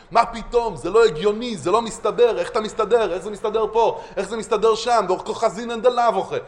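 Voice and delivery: acted indignant disputing, insistent; combative challenge. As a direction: lock horns with the argument. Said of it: "הוא מתנגח איתו".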